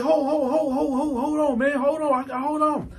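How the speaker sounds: high, repetitive stammer; flustered pleading. Retold or imitated. Caught bunkie pleading for a moment, flustered. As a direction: stall frantically in a high voice.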